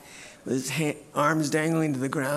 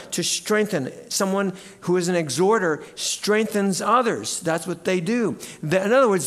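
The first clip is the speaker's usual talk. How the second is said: ordinary talk, nothing marked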